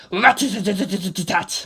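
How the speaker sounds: rattling rat-a-tat gunfire imitation; mouth-made machine gun fire; machine gun sound. A narrator's reading. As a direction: spit out the gun burst.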